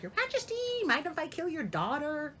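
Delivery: high, lightly voiced courteous huntsman; mock polite huntsman. Huntsman lightly voiced asking the king, mock polite. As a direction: ask it with absurd politeness. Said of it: "something along the lines of".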